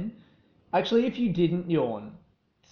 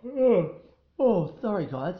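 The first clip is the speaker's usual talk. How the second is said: talking through a big yawn; yawn, then apologize as it tails off; 4 semitones higher, slower than usual. Real, not acted. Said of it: "obviously heard me yawn".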